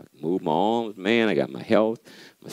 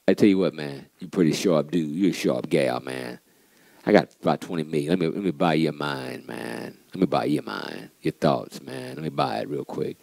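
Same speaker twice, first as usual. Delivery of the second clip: smooth buyer; 6 semitones lower, 5 dB quieter than usual. Retold or imitated.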